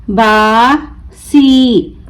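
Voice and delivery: बासी very slow, long बा, pause, long सी; broken into syllables. Shown as a demonstration. Teacher breaking the word for learners, बा... सी stretched apart. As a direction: draw out बासी as two separate syllables. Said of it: "तोड़ तोड़ कर पढ़ूंगी".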